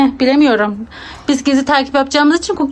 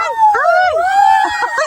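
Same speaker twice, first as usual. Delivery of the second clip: burst of laughter. Real, not acted. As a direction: laugh out, barely holding it in. Laughing while hiding.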